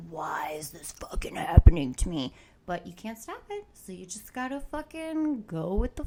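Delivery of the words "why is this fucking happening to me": exasperated whisper. Retold or imitated. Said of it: "you're like".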